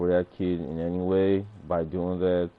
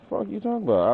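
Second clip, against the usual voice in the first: pitched way up, mocking; high mocking squeak; 11 semitones higher, faster than usual. Streamer mocking a critic in a high squeak. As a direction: squeak it like a taunt.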